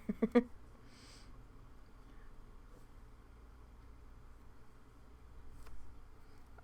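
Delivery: long airy laugh after the story; breathy laugh. Real, not acted. Laughing at her own comeback to the supervisor. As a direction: laugh it off, wheezing.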